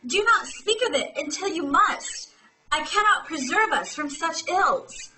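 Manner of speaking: hammed-up outcry, pitched high; dramatic cry. Atrium crying out after hearing the message, dramatic. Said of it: "And then she cries".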